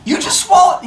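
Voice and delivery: high, loud disbelieving accusation; hammed-up incredulous outburst; mock outrage. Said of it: "He's like".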